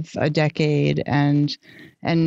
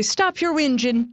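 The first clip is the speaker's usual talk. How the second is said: higher-pitched imitation of an Irish mother; mother's Irish accent; 7 semitones higher than usual. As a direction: scold like a mum with an Irish accent. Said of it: "It was the Irish accent".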